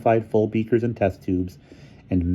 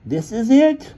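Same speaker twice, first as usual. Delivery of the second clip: character voice; 5 dB louder, slower than usual. A narrator's reading. Featherstone voiced high.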